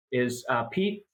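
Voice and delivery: ordinary talk, nothing marked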